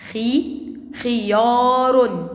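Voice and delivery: خي alone, then خيار very drawn out; slow sounding-out. Shown as a demonstration. Teacher rereading words to pupils, slow demonstration. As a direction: give خي, then stretch خيار slowly. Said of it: "نقرأ الكلمات مرة تانية".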